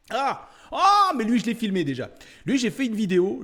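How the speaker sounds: loud, high cry of happy surprise; excited recognition. Real, not acted. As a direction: burst out in delight at spotting a favourite. Delighted recognition of a familiar scene. (exclamation ravie, forte et aiguë)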